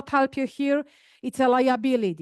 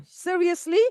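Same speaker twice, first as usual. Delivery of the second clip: surprised; 5 semitones higher than usual. Real, not acted. Coach catches a wrong move.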